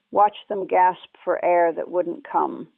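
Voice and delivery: plain reading voice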